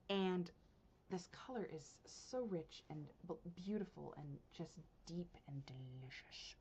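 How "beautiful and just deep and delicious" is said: low, hushed relish; soft, reverent appreciation; savouring murmur. Real, not acted. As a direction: drop to a near whisper, savouring it. Savouring the yarn's colour.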